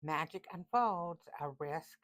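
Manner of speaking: a hint of a theatrical seer; dramatic oracle. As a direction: intone it like a fortune teller. Dramatic reread of the card.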